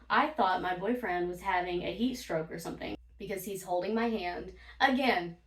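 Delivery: usual speaking voice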